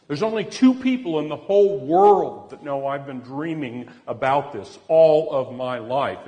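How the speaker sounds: acted-out angry outburst, stretched for emphasis; livid accusation. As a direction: confront them, loud and incredulous. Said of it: "She just went livid".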